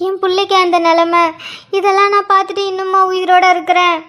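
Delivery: mother's tearful wail; crying. Acted. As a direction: lament for your son through tears. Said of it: "அழாதீங்க அண்ணி". (அழுதுகொண்டே புலம்புவது)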